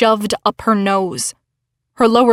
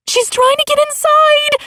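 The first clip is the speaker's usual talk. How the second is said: panicked cry. A narrator's reading. Panic as Veronica climbs.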